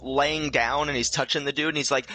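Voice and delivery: ordinary delivery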